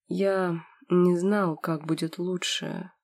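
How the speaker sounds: slow, faltering uncertain words; hesitate, unsure of himself; hesitant. Acted. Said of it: "замялся он".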